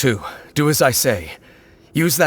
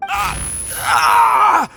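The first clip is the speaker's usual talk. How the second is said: sudden high cry of pain, the second one louder; startled pained shouts; 3 dB louder than usual. Acted. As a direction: cry out as a sharp jolt hits you. Sudden disturbance felt, friend asks what's wrong.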